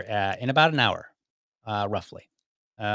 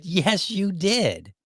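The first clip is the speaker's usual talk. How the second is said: drawn-out, high-pitched insistence; insistent; 8 semitones higher, much slower than usual. Retold or imitated. Employee contradicts his forgetful manager, slowly insistent. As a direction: stretch each word in exasperated confirmation.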